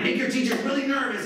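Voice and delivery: high, pumped-up hype voice; rallying the kids. Retold or imitated. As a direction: egg on a crowd of kids.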